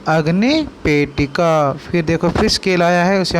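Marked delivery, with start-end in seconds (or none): 0.1-1.7 अग्निपेटिका slow, every syllable clear; अग्निपेटिका धीरे-धीरे; slow teaching word. Shown as a demonstration. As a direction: spell out अग्निपेटिका slowly. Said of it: "क्या बोलते हैं?".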